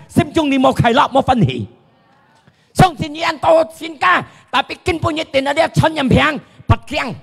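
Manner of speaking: sustained high pitch, rising in force; fervent preaching. Acted. Preacher urging listeners to believe in God, fervent.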